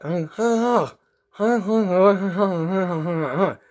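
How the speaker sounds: high string of muffled open-mouthed syllables; mouth-guard garble. Retold or imitated. Sarcastic patient testing the dentist, mouth-guard garble. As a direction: imitate a tongue-twister through a stuffed mouth. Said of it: "huge mouth guard in their mouth".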